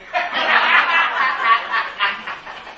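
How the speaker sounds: tiếng cười ngắn sau câu nói đùa; cười xòa cho qua; bật cười. Real, not acted. (cười xòa)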